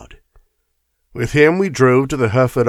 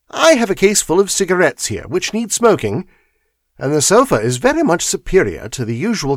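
much higher, lilting Holmes; 7 semitones higher, 4 dB louder than usual. Acted. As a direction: play the dry, confident detective. Holmes voiced in story.

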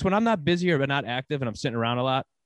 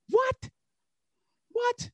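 disbelief. Real, not acted. Reacting to absurd quote.

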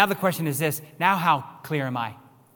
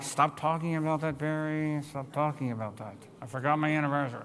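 soft, lightly voiced mock exasperation; mock annoyed friends; 7 dB quieter than usual. Friends telling Barry to drop it, softly mocking exasperation. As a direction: hush him off with a put-upon sigh. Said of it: "people are like".

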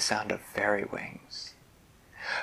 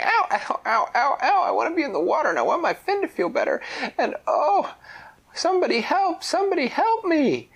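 high, loud, fast pained pleading; crying for help; 5 dB louder than usual. Acted. Stranded pufferfish begging for help, high loud wail. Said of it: "She was just laying on this beach going".

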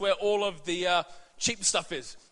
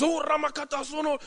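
higher, lightly voiced mock foreign ranting; mock gibberish rant; 8 semitones higher than usual. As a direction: imitate an angry stallholder's babble, light. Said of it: "He started, like, speaking in tongues".

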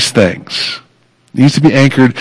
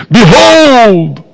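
put-on Behold called out high and ringing, like old scripture; a mock-Elizabethan Behold, much higher and ringing; grand old proclamation; 10 semitones higher, 3 dB louder than usual. Shown as a demonstration. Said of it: "Elizabethan thing".

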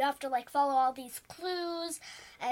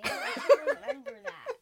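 laughing; 12 semitones higher, 4 dB louder than usual. Real, not acted. Laughing protest.

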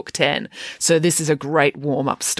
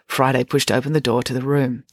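ordinary delivery

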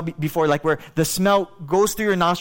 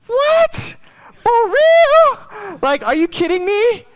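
high, loud incredulous outburst; shocked disbelief; 8 dB louder, much slower than usual. Retold or imitated. Pastor telling the church how he blurted it, high and loud in shock. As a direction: yelp it out, totally floored. Shocked reply to idol worship remark.

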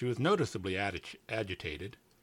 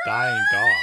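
loud teasing shout; 13 dB louder than usual. Real, not acted. Mocking partner's take.

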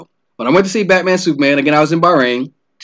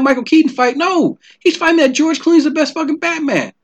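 much higher, incredulous inner rant; exasperated disbelief; 10 semitones higher than usual. Retold or imitated. Recalling his thoughts while arguing with shipmate, high exasperated disbelief. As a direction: sputter in baffled outrage at this guy.

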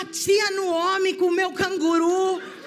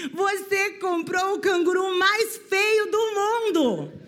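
higher, slow, laughing mockery; zombando rindo; 5 semitones higher, 4 dB louder, slower than usual. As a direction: tease the child while laughing. (deboche risonho e lento)

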